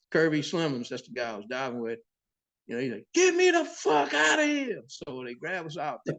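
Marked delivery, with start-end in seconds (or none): 3.1-4.8 very high, drawn-out, loud holler; slow, high-pitched yell of terror; panicked yell. Retold or imitated. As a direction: reenact a diver screaming to be hauled out. Retelling partner demanding rescue from sharks.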